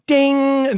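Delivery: high-pitched chime imitation; elevator ding. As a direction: ring out like a lift bell.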